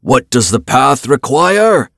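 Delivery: loud, high, slow battle shout; rallying cry. A narrator's reading. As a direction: cry it out to rally your people. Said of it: "he cried to his people".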